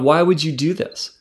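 ordinary talk

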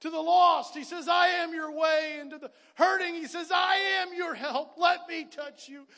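loud, rising preacher's call over the music; full-force preaching. Acted. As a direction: preach it out, voicing God's call to the hurting.

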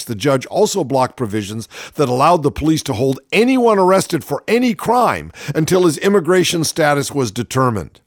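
ordinary delivery

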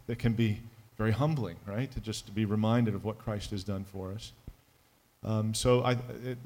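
ordinary talk

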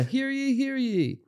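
high, proclaiming hear ye; town crier. Pretend. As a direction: call it out like a herald. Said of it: "announce us like that".

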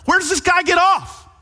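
high, loud indignant shout; outraged yell. Retold or imitated. David losing his mind at the rich man, loud outraged shout. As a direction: shout it in disbelief. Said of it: "David's yelling, losing his mind".

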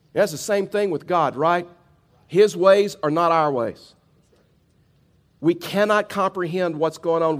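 preaching. Acted. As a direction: press the point home from the pulpit. Preacher driving home his point.

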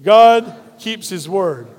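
God keeps his word said slowly, each word weighted, God stressed; slow congregational refrain. Shown as a demonstration. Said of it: "Say it with me".

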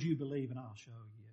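God calmly turning the demand around. Retold or imitated. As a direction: answer gently and briskly. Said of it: "God says".

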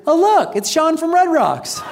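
high, slow, delighted greeting; light up like you spotted a celebrity; cheery recognition. Retold or imitated. Paramedic recognizing the pastor on the garage floor, delighted and high. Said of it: "the first thing I hear is".

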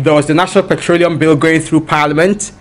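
ordinary delivery

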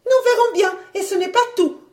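very high, loud defiance; threatening wife. Acted.

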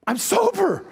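high-pitched astonished exclamation; astounded. Acted. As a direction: act out stunned joy, pitch leaping up. Preacher re-acts the man's amazement.

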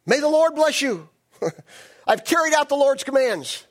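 high, hammed-up gleeful hail; mock-jubilant, high and loud; cheerful greeting. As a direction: sing out a grand, over-cheery greeting. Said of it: "Saul greeted him cheerfully".

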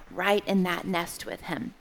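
ordinary talk

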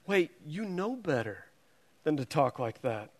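imitating a soft, let-down correction; gentle reproach. Family holding their teacher to account, softly reproachful.

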